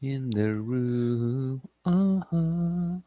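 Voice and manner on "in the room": stretched, slow mock reading; slow drawn-out echo. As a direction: drag each word out slowly. Drawn-out echo of game line.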